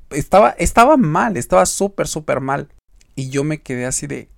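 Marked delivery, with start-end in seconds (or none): none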